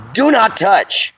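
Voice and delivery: slow, high stern warning; each word slow and firm. Real, not acted. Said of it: "warning viewers about spikes".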